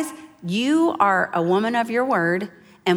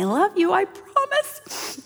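very high, drawn-out whimper; hammed-up weepy squeak; mock tearful; 11 semitones higher, slower than usual. Mother leaves her baby to cry, mock-tearful whimper.

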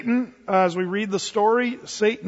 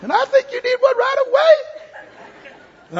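much higher, louder coach line, slowing and breaking into a laugh; remembered coach voice; 5 dB louder than usual. Retold or imitated. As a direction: replay the coach's voice, laughing at the end. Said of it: "I hear his voice off in the distance".

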